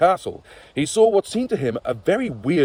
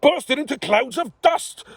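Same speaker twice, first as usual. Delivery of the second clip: higher, mock roar; king roars at knights bursting into dust; exasperated roar; 12 semitones higher than usual. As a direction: roar at them in despair. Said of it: "Hurler roared".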